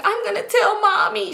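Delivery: high-pitched mimicked whine; crying Cheryl. Little girl crying, threatening to tell mom, mimicked whine. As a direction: imitate a tearful kid threatening to tattle. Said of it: "She's crying".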